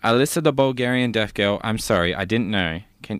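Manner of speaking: ordinary delivery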